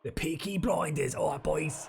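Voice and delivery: put-on slow Peaky Blinders Birmingham accent; playful Birmingham gangster accent, unhurried; mock Brummie accent. Quoting show in Birmingham accent.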